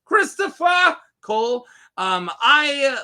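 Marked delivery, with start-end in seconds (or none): 0.1-1.7 high-pitched, hammed-up name call; mock proclamation. Reviewer fumbling a knight's name, mock high proclamation. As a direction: belt out the name in a playful high voice.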